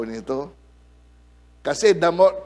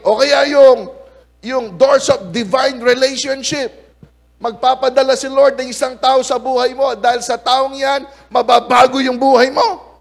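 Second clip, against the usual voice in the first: raised, louder preaching voice; 8 semitones higher, 6 dB louder than usual. Acted. Pastor proclaiming God's doors to the congregation, raised preaching. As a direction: proclaim it to the whole church.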